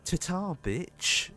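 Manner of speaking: Thanos. Pretend. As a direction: brush them off like a cocky villain. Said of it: "Thanos has just walked in and just gone".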